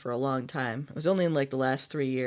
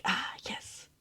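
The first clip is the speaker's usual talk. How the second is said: smug, satisfied whisper; a hushed, self-satisfied "Ah, yes"; mock smug whisper; 9 dB quieter than usual. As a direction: whisper it like a gloating secret. Smug reply to guests' text.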